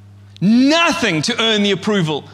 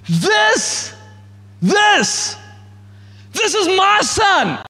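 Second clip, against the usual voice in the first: high joyful proclamation; 10 semitones higher, much slower than usual. Pretend. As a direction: burst out with joy on each this. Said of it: "the Father looks at his Son and goes".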